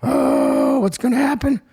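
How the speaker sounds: high-pitched mock panic; mock fretting. Comedian imitating his own anxious younger self, mock fretting. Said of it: "I was like".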